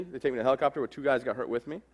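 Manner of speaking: ordinary delivery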